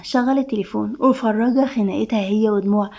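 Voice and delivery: usual speaking voice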